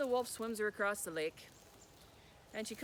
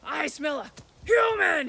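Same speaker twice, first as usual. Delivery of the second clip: slow, loud, higher monster roar; troll storming through the door, roaring; troll's roar; 6 semitones higher, 15 dB louder, slower than usual. Acted. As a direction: bellow it as the angry troll.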